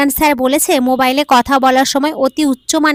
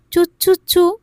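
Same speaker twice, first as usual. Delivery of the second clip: kissing sounds; 6 semitones higher than usual. Retold or imitated. Wife repeats phone kisses.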